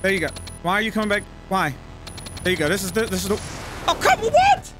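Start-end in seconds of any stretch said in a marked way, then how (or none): 3.9-4.7 high squeaky outburst of disbelief; startled shriek. Real, not acted. Game character moves unexpectedly.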